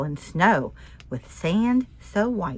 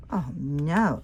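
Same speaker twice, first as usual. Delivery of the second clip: ordinary delivery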